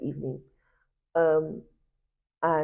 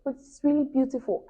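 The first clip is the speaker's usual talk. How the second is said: high-pitched coaxing sister voice; consoling sister; 9 semitones higher than usual. Retold or imitated. Sister reassuring her about the grade, high and coaxing. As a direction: soothe her like the sister did. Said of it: "she was like".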